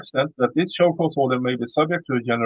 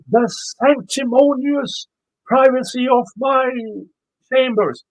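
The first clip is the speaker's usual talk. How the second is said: high, loud, drawn-out pompous mockery; slow, scornful quote, pitched way up; mocking the judge; 10 semitones higher, 4 dB louder, slower than usual. Quoting what the judge said about chambers, mocking.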